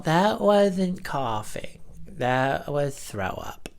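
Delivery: slow, dropping to a whisper; telling the boss it was vomit, half-whispered; awkward confession. Retold or imitated. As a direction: confide it awkwardly, trailing to a whisper. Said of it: "confessing to boss".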